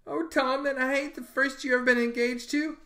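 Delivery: high, hurt little cry of dismay; a wounded girl realising she's not the first. Acted. Becky hurt on hearing of Amy, high dismayed voice.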